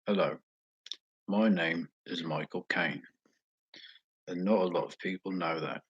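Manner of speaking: English accent impression, punched loud hello; hammed-up famous English actor introducing himself; Michael Caine. Shown as a demonstration. Streamer showing off his better impression, English actor voice. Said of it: "here's my Michael Caine impression".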